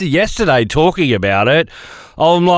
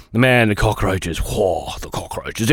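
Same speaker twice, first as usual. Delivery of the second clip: disgusted hush; 5 semitones lower, 5 dB quieter, slower than usual. Real, not acted. Disgust at cockroaches in tent.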